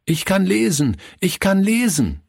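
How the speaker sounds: put-on high, excited child's call; proud boy calling out; calling out happily. A narrator's reading. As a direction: shout it to everyone with joy. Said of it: "rief er jedem zu".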